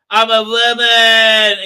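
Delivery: slow, high, loud parody wail; mocking cry. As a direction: stretch it into a silly proclamation. Mocking a character's declaration.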